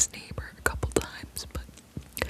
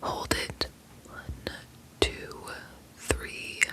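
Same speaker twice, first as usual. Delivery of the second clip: guided breathing. Acted. ASMR host calming herself and viewers, whispered guided breathing. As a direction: calmly guide each inhale and hold.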